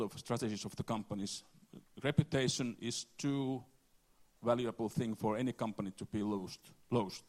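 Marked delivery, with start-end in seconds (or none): none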